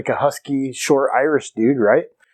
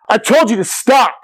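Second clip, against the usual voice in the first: louder, firmer command with "told" hit hard; 7 semitones higher, 5 dB louder than usual. Shown as a demonstration. Instructor warning a threatening man, louder firm command. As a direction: make it clear you won't ask again. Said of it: "you can see the difference in my tone".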